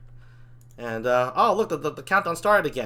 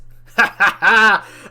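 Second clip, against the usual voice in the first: gleeful laugh; 8 dB louder than usual. Acted. Demon delighted by the Pluto plan, gleeful laugh. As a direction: laugh out loud in triumph.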